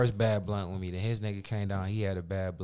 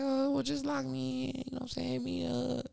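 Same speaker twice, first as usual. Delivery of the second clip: imitating her; 3 dB quieter than usual. Caller asking Mike to save her number, high soft imitation. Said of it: "She's like".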